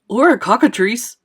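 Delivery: loud yell of alarm. A narrator's reading. Police chief names the monster overhead, yelling. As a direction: yell out a frightening guess. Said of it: "yelled the chief of police".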